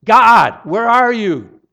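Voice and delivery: loud anguished cry, pitched high; preacher shouting God's name in anguish; screaming to God. Shown as a demonstration. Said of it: "could be translated screaming".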